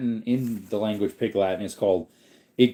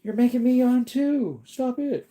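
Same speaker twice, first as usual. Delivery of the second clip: mock whiny viewer; 14 semitones higher than usual. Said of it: "You guys are like".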